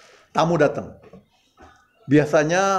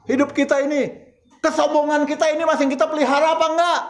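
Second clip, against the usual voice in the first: demanding self-examination from the pews; fiery preaching; 11 semitones higher, faster than usual. Acted. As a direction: press the questions home like a rising sermon. Preaching at full force.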